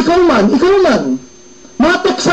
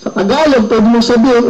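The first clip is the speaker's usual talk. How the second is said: usual speaking voice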